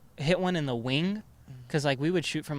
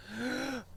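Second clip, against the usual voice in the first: imitated sharp gasp; 8 semitones higher, 10 dB quieter than usual. Friend shot his own toe.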